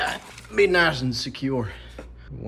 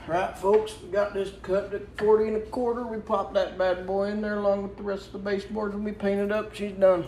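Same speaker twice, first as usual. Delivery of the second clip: high, folksy put-on twang of a good old boy; lightly hammed-up country-style put-on accent; 7 semitones higher than usual. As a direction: ham up a folksy down-home accent for the fellas. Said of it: "I don't really know what this accent is".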